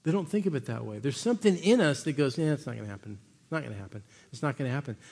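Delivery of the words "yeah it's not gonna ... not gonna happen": dismissive mutter. Retold or imitated. Voicing people's inner dismissal.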